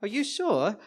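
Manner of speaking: high, haughty mock-British doubt; put-on British accent. Wine-sipping fake Brit doubting the man, in a British accent. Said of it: "pulling out my British greatest hits".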